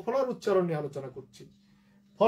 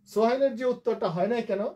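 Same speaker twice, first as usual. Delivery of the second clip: ordinary talk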